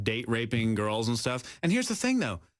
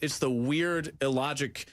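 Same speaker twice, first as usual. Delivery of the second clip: ordinary talk, nothing marked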